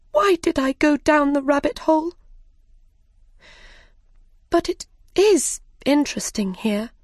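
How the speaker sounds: put-on a light touch of a fretting girl; young Alice's voice. A narrator's reading.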